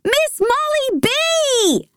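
very high, drawn-out calling out; long sing-out call to a friend; excited yell. A narrator's reading. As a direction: holler the name across the yard. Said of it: "I yell as I run".

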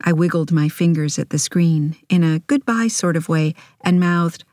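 ordinary delivery

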